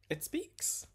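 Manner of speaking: very high-pitched reveal; high, excited pitch; eager announcement. Acted. Daughter's announcement, echoed by father.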